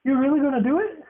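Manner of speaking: fast, high, thrilled asking; excited disbelief. Real, not acted. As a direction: bounce with disbelief. Repeated faster and higher.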